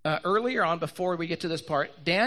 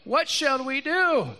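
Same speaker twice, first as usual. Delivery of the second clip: higher, drawn-out cry for help; mildly panicked servant, pitched up; mock freaking out; 6 semitones higher, slower than usual. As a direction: act out a panicked plea, lightly. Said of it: "And he's freaking out".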